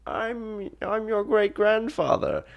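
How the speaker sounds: high, put-on old man voice. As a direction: play the old great-grandfather. Great-grandfather voiced in story.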